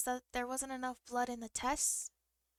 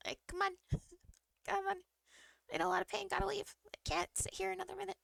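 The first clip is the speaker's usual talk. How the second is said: eager to leave; 8 semitones higher, faster than usual. Retold or imitated. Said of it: "And I'm like".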